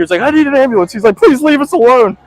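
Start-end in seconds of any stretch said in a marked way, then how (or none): 0.2-0.9 much higher and louder desperate demand; mock frantic plea. Friend begs the old husband for an ambulance, high loud panic. Voicing Tanner begging the husband. 1.2-2.2 put-on much higher and louder pleading old man voice; mock frightened old man. Old husband begs the shirtless stranger to leave, high loud mock plea.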